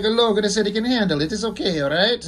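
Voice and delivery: hammed-up Italian accent for a comic character. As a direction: bounce through it like a cartoon Italian. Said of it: "I make him sound Italian".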